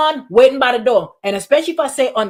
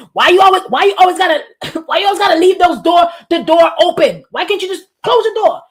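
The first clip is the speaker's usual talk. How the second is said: higher, louder mock nagging; exasperated complaint; 6 semitones higher, 5 dB louder than usual. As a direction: nag about the doors, fed up. Acted complaint about open doors.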